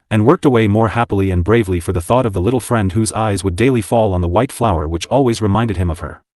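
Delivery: ordinary delivery, nothing marked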